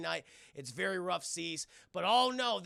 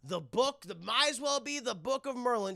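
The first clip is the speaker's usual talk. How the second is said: ordinary talk, nothing marked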